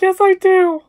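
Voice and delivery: high, loud whine; hammed-up wailing admission; mock tearful insistence. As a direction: protest it like a weepy confession. Retells her tearful insistence.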